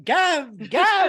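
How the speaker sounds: high, loud name call; mock whale yell. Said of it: "the whales yelling back at you".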